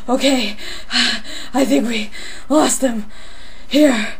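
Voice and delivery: panting after running away; out of breath. Acted. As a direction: speak between heavy breaths. Catching breath after fleeing.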